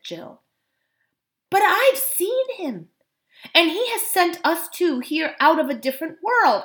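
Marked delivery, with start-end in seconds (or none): 1.5-6.6 put-on high, insistent girl's voice; girl pleading to be believed; earnest girl. A narrator's reading.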